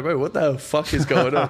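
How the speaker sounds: slow, laughing 'what the hell' at a crazy video; half-laughing stunned reaction; baffled laughing disbelief. Retold or imitated. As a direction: laugh through the disbelief, drawn out. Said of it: "I was like".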